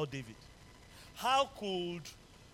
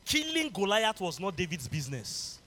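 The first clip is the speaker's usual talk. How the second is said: normal talking voice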